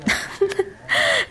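bright high burst of a laugh. Real, not acted. Girl laughing at the boy's coffee joke, high giggle. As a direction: let a high laugh escape at his joke.

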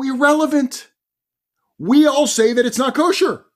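loud, high dismissive protest; frustrated rabbis. Retold or imitated. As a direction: overrule him at full voice, exasperated. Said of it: "It's getting more frustrated".

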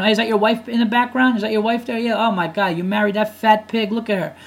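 mock taunting. Imagined doorstep visitor to bully's father, taunting. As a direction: taunt him with put-on nosy disbelief. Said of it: "start taunting".

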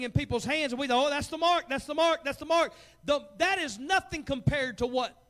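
loud, high fiery sermon warning; preaching at full force. Acted. Preacher warns his church, loud and high. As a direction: hammer the warning home, preacher style.

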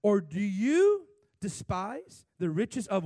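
reading scripture aloud to the congregation, slow and booming; loud slow scripture reading. Shown as a demonstration. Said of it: "Read it with me out loud".

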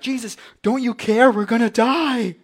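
high, loud acted panic; freaked out. Preacher voices disciples pleading with sleeping Jesus, freaked out. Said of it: "starting to get freaked out".

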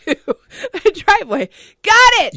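loud, high triumphant; excited. Real, not acted. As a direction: shout it in triumph.

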